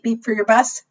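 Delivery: usual speaking voice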